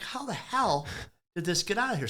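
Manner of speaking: high, startled squawk of disbelief; shocked disbelief. Retold or imitated. Mechanic finding the missing fender boards, high shocked disbelief. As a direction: jump way up in pitch, baffled. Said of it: "I'm like".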